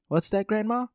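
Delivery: high, light mock child voice; put-on innocent grandkid; questioning grandchild. Voices grandchild who overheard the muttering.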